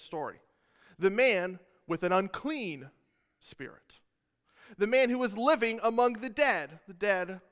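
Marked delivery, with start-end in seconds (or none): 3.4-4.0 whispered word. Real, not acted. Stressing unclean things.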